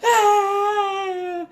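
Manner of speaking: high, loud crying wail. Acted. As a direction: wail at full force. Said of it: "wailing and crying".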